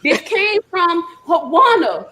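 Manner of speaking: retelling showing her son the email, excited; excited mom. Retold or imitated. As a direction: thrust the email at him, thrilled. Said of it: "I was like".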